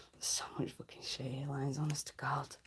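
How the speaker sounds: breathy whispered aside. Real, not acted. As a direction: whisper it to the viewers.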